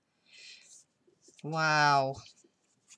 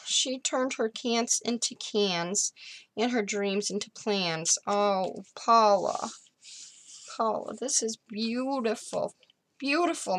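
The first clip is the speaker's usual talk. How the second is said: overwhelmed and high; 6 semitones higher than usual. Real, not acted. Reading the quote overwhelmed.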